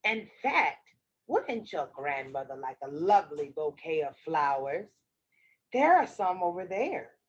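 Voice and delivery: low, slippery wolf voice; sly wolf. Acted. Wolf flatters the child he wants to eat, low sly voice. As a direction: flatter her in a low voice, catching the slip.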